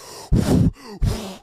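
a breathy whoosh of wind; wind imitation. Describing the scary drive, a lightly done wind whoosh. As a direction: blow out a howling gust. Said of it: "All you hear is".

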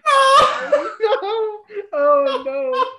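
very high shriek through laughter; laughing shriek. Real, not acted. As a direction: squeal it while cracking up. Spill ends up in his beard.

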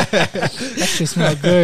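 slow words through laughter; laughing. Real, not acted. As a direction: stretch it out while laughing.

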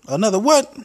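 high loud squawk; Trump character. Acted. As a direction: blurt it up high.

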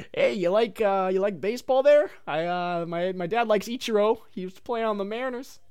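acting out a nervous grown-up fishing for a topic; awkward small talk. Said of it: "kind of scared to talk to kids".

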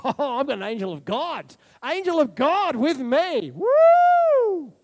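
playfully satisfied, building to a high loud whoop; mock giddy delight. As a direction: ham up being easily contented, then whoop. Mocking complacent people's response.

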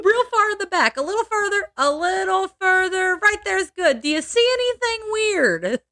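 high, sugary coaxing killer husband; mock husband coaxing. Husband coaxing wife into chimney.

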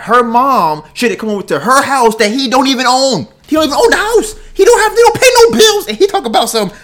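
building from loud to high, shouting indignation; rising outrage. Real, not acted. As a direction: let the outrage climb into a shout. Ranting about boyfriend.

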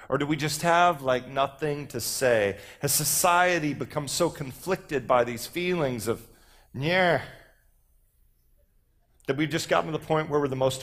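performed exasperation with filler-word stumbles; poem recital. Acted. Speaker recites a poem mocking an inarticulate generation, animated declaiming.

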